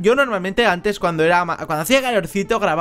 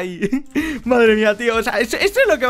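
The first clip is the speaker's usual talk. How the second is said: words broken by real laughter; real laughing aftermath; 3 semitones higher than usual. Real, not acted. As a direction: laugh through it after the win.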